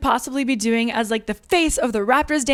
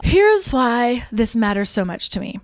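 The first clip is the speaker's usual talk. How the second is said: ordinary talk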